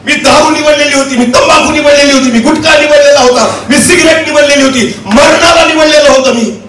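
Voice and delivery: sustained declaiming sermon delivery; impassioned preaching. Acted. Preacher testifying about choosing Jesus over death, impassioned preaching. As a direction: testify in driven, ringing phrases.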